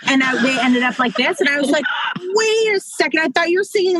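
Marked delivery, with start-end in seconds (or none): 2.2-4.0 higher-pitched mock double take; startled parent. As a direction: halt them in sudden disbelief. Mother acts out startled double take.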